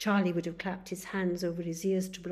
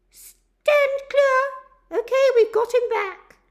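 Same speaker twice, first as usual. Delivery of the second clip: much higher, much louder urgent shout; paramedic shouting; 18 dB louder than usual. Acted. Paramedic revives Charlie and shouts to the crew, urgent. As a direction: bark orders over the patient.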